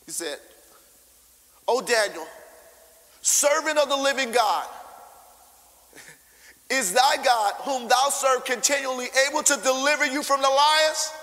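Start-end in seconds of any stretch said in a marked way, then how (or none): 1.7-11.1 calling loud and high into the den; a desperate king calls down into the pit; king's lament. Retold or imitated.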